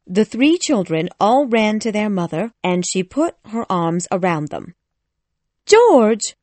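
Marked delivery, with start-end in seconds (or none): 5.7-6.4 high, loud call to husband; crying out. A narrator's reading. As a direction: shout the name urgently, high and loud. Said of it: "she cried out".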